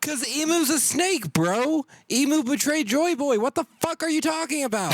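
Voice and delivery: high-pitched outraged yelling. Real, not acted. Fan arguing that Emu's a snake, yelling. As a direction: yell it out, pitch far above normal. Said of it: "Don't mean to yell like this".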